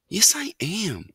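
put-on slow, high, stubborn young mouse; stoutly. A narrator's reading. Young mouse insists he is old enough, stoutly. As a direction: stand your ground, drawing it out. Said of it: "said Ralph stoutly".

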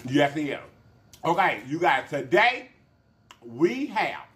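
normal talking voice

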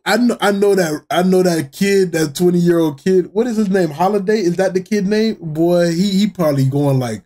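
normal talking voice

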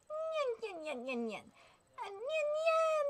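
cutesy cat noises; cat-like ninja. Acted. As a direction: meow it out like a sneaky cat ninja.

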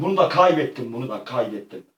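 ordinary delivery

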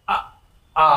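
ఆ sounded from the throat; throat vowel. Shown as a demonstration. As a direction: say ఆ as an isolated guttural vowel. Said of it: "ఇవి కంఠం నుంచి పలకాలి". (ఆ కంఠం నుంచి పలకడం)

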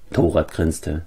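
ordinary delivery

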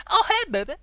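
very high, loud hail; flirty greeting. Pretend. Gamer greeting a townswoman, excited flirty call.

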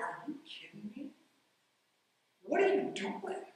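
quiet disbelieving mutter under his breath; muttered to himself. Retold or imitated. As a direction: mutter it so only you can hear, in disbelief. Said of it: "I said to myself".

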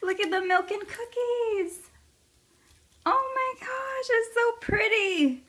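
high thrilled praise; excited. Real, not acted. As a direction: coo over it. Delighted high gushing.

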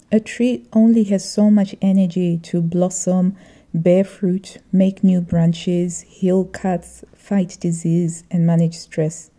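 ordinary talk

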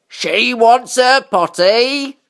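high, very slow drawn-out call; long-drawn character cry; gardener crying out. A narrator's reading. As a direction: stretch each word as the calling gardener. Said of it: "cried the gardener".

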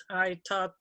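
normal talking voice

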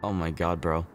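normal talking voice

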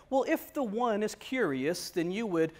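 quietly ponderous imitation; Doctor Vaughn. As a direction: imitate an odd, formal scholar, unhurried. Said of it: "he talked very weird".

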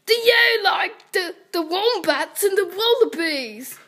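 very loud, high Australian voice; Australian accent. Acted. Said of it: "talking in an Au-, Australian accent".

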